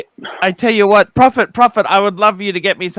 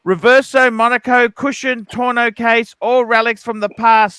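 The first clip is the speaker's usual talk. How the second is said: louder, pumped super chat read; hyped-up read; 4 semitones higher, 4 dB louder than usual. Real, not acted. Host reading a viewer's super chat, hyped and louder. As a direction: sell every watch name like a hype man. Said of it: "That's the way to read a super chat".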